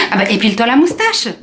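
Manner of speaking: normal talking voice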